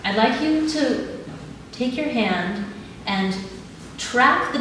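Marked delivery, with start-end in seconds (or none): none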